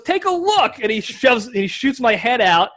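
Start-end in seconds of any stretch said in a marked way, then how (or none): 0.0-0.8 much higher, bossy coach; mock coach command. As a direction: bark it like an impatient coach. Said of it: "and he goes".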